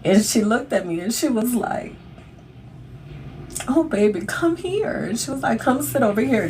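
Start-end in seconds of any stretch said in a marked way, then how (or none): 3.7-6.5 oh-baby tenderness, voiced high; tender doctor. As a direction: comfort her like a crying child. Doctor comforting her as she cries.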